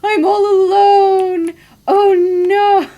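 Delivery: high, loud, slow mock wail; voicing the lonely dog. Lonely dog howling home alone, mock wail. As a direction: ham up the dog's lonely cry. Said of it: "'cause he's like".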